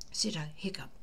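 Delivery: ordinary delivery, nothing marked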